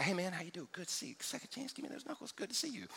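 hushed, low-key greeting. Retold or imitated. Pastor greets churchgoer discreetly, hushed low-key voice. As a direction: keep it quiet and casual. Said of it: "we keep it low key".

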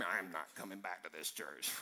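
low, soft imitation of a put-off congregant; mock offended grumble. Preacher voicing an offended churchgoer after his UFO joke, low grumble. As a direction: grumble it under your voice.

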